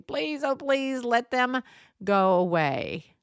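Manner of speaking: drawn-out imitation of a silent plea; mock pleading. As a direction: beg slowly, starting high and dropping at the end. Retold silent plea to be left alone.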